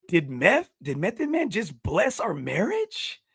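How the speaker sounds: slow, high, swooping disbelief; imitating amazed wife. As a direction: act out the wife's stunned question. Voices wife's amazed question.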